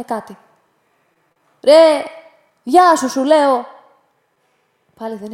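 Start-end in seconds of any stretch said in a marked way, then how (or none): 1.6-3.7 loud, slower, pushing hello; man from the boat, ignored, shouts his greeting again; insistent shout. Acted. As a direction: demand an answer, voice raised high.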